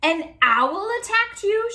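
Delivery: high, loud, slow astonished question; shocked question. A narrator's reading. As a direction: gasp out the question in disbelief. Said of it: "she asked".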